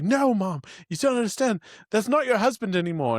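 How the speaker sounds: urgent pleading. Retold or imitated. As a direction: plead with Mom, pitch pushed up high. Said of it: "I was like".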